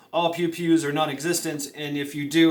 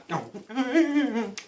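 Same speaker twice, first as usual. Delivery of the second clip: short high giggle; high laugh; 8 semitones higher than usual. Real, not acted. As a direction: burst out laughing. Laugh after joke.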